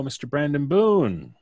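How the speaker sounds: ordinary talk, nothing marked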